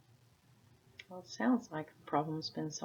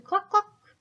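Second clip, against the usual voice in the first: high clucking cluck, cluck; high hen cluck; 9 dB louder than usual. Acted. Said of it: "No hen said".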